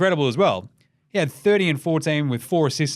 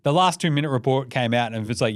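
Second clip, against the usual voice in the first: ordinary talk, nothing marked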